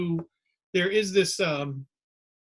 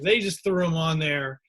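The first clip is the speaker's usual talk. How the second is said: ordinary talk, nothing marked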